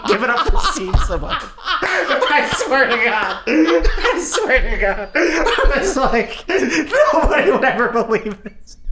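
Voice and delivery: high, wheezy squeal of laughter; laughing hard. Real, not acted. Comic retelling Joey's introduction of him, laughing hard. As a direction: struggle to get the story out while cracking up.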